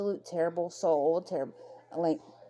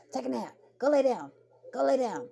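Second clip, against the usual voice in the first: higher-pitched mock parental order; imitated scolding mother; 7 semitones higher than usual. Retelling a mother sending her son to bed, imitated sharp command.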